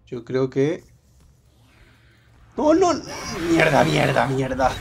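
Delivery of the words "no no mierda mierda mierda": sudden loud alarmed swearing; yelling in a panic, half laughing; panicked cursing. Real, not acted. Enemy spots him, panics. (maldiciendo en pánico, a gritos)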